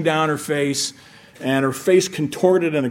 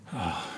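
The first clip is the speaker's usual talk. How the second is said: a barely voiced, breathy sigh let out under the breath; soft sigh; 8 semitones lower, 14 dB quieter than usual. Preacher showing Jesus sighing inwardly, soft sigh. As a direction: sigh the tension away quietly, almost to yourself. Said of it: "mentally he went".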